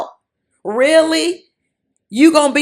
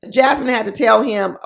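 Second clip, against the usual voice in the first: normal talking voice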